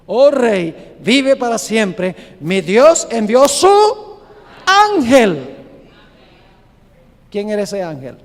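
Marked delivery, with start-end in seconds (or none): 0.1-5.6 respuesta proclamada. Acted. Daniel responde desde el foso.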